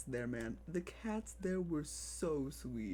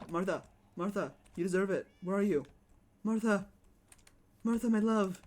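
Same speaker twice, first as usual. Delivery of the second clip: louder, calling across the room; 3 semitones higher, 8 dB louder than usual. Real, not acted. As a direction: call the cat with big affection. Calling loudly for the cat.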